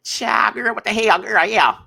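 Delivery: loud, high sassy auntie exclaiming; Madea-style outburst. Real, not acted. Reacting to the clip, a loud Madea-like exclamation. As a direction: shout it like Madea would. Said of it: "you gonna make me talk like Madea".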